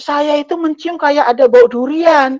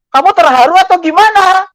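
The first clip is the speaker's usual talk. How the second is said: higher, louder startled asking; surprised question; 7 semitones higher, 6 dB louder than usual. Retold or imitated. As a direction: ask the child, caught off guard. Retelling asking crying child.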